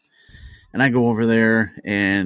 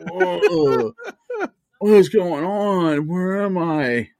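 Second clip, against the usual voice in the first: higher, slow, dazed woman waking up; groggy drunk woman; 8 semitones higher, much slower than usual. Acted. Neighbor kicked awake on the grass: higher, slow, dazed voice. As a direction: drag it out like you just came to, confused.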